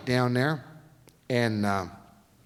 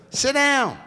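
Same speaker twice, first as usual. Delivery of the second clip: higher, louder barked order; mock command; 13 semitones higher, 9 dB louder than usual. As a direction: bark the order like the boss. Imitating France commanding.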